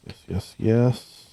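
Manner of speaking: usual speaking voice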